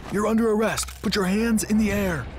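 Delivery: policemen bursting in to arrest the kidnappers; police shout. Acted.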